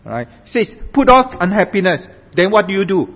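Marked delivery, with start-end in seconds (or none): 0.9-2.1 "unhappiness" set out firmly in a raised voice; stress on unhappiness. Shown as a demonstration. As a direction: lift the phrase to emphasize "unhappiness". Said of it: "I want to emphasize the word unhappiness".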